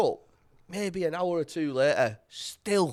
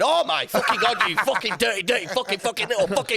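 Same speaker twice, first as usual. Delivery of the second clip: a higher, heated telling-off; mum's angry rant; 6 semitones higher than usual. Retold or imitated. Mum berating her son over the mess, heated higher rant. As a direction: rant at him like a disgusted mum.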